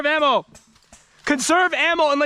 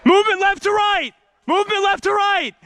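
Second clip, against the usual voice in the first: urgent callout; 7 semitones higher than usual. Real, not acted. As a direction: alert the squad fast. Warning of enemy movement.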